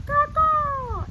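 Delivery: high, loud crow cawing with a changed voice. Shown as a demonstration. Reading the crow's line to children, high loud caw. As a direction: squawk it like a crow. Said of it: "It says".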